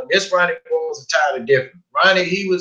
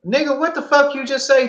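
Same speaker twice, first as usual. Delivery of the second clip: indignant retort; 11 semitones higher than usual. Retold or imitated. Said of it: "I'm like".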